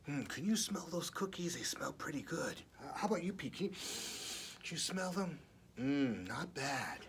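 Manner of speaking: soft, fast whispered aside to the listener; hushed aside. Acted. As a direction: lean in and whisper the question. Aside asking child to smell.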